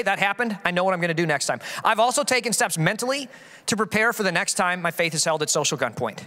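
normal talking voice

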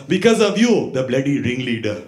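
put-on accusing Indian-accented grandpa; blaming grandpa. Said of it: "I used to get blamed for it".

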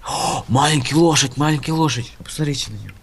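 put-on light Tajik Russian accent; broken Russian, Tajik accent. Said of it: "как таджик".

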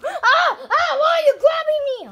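high, loud panicked shout; grabbed customer shouting in alarm; panicked yell. Acted. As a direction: scream as you're grabbed.

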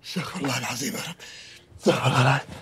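hushed muttering for self-control; soft, exasperated plea for forgiveness; muttered prayer. Acted. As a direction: mutter it low to yourself. Father mutters to calm himself.

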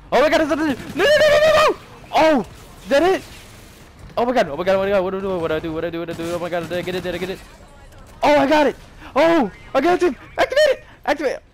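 high, loud frantic yelling; panicked excitement. Real, not acted. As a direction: panic with joy, shout it out. Nuke earned, panicking to activate.

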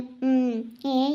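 ordinary talk, nothing marked

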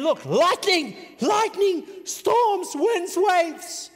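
high, loud shouts, word by word; storm alarm. Acted. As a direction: yell out each danger as it hits.

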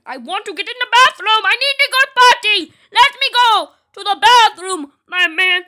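put-on high, loud childish begging; mock desperate yell. Player locked out of the bathroom, mock desperate yell. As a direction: yell it like a play tantrum.

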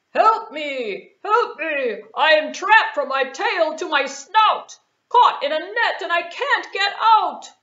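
loud, pleading lion cries; a big lion stuck and begging; trapped lion. Acted. Lion caught in the net begging for help, loud.

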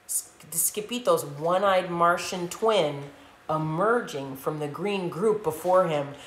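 ordinary delivery

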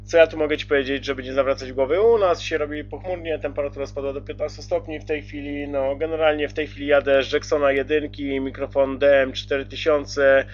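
ordinary delivery

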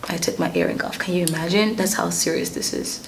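dropped to a near whisper; hushed aside. Real, not acted. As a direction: lean in and say it low and secret. Dramatic hushed aside to viewers.

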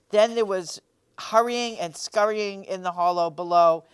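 ordinary delivery, nothing marked

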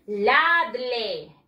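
"Ladle" read as "LAH-dleh", with Spanish vowels; Spanish spelling pronunciation. Shown as a demonstration. Teacher shows pupils how it is written, Spanish spelling pronunciation. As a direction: sound out "Ladle" letter by letter as in Spanish. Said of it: "Se escribe de esta manera".

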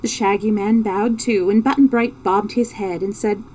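plain reading voice

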